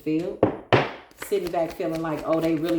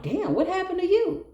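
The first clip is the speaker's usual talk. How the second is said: much higher, startled "Damn"; mock shock; 12 semitones higher than usual. Seeing someone swell up, a much higher mock-shocked cry. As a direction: gasp it out like you can't believe the swelling. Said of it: "You're like".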